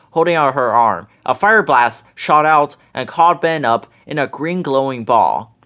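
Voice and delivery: ordinary delivery, nothing marked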